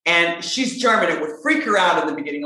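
ordinary talk

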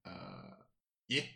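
very soft, halting reply; meek, quiet goblin henchman; hesitant underling. Acted. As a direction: answer small and unsure, barely audible. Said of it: "Green Arm kinda goes".

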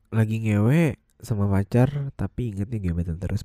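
ordinary talk

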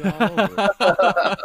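said through a laugh; real laugh while saying it. Real, not acted. Eager to sing [name] songs.

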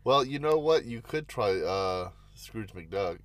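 ordinary talk, nothing marked

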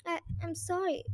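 soft, halting apology; stammering. Acted. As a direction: stumble over the words, quietly. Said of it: "Sophie stammered".

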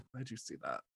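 very soft, low, mortified mutter. Retold or imitated. Actor remembering being seen at her worst, soft low groan. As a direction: groan it under your breath, low and soft. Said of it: "I'm like".

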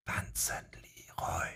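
voiceless, very soft whisper into his ear; barely breathed whisper. A narrator's reading. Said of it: "Kaum hauchend wisperte sie".

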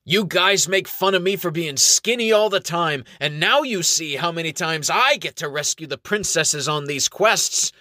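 proud skinny elf. A narrator's reading. Elf boasting to friends about rescues, proudly. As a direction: chest puffed out, brag it. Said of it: "puffs out his chest proudly".

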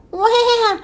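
high, imitated wail; baby seal cry. A narrator's reading. Baby seal heard from the beach, crying. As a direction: bleat like a crying seal pup. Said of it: "the baby seal cried back".

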